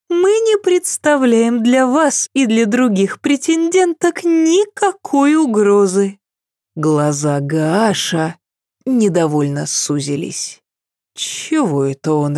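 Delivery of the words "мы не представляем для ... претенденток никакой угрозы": high, sweet innocent voice; light and untroubled reassurance; serene princess. A narrator's reading. Princess Cecile keeps talking. (безмятежный голос принцессы)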